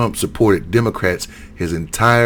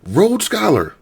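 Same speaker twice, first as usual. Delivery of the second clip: incredulous repeat; 11 semitones higher, slower than usual. Real, not acted. Repeating credentials in disbelief.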